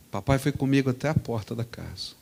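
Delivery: ordinary talk, nothing marked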